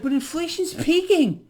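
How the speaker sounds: high mocking voice on peaking; sarcastic quote. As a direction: sneer the official claim up high. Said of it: "No end in sight".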